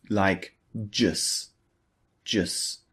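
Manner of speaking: "jus" with no final T, the second one breathed; dropped T in just. Shown as a demonstration. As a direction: clip the T off "jus". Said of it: "the T at the end of just is not pronounced fully".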